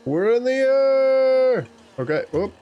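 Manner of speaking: usual speaking voice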